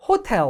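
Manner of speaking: 호텔 with 호 high and strong; wrong stress on 호. Shown as a demonstration. Traveller asking abroad for some hotel, stress on 호. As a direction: punch 호, let 텔 drop. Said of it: "이렇게 발음을 하면".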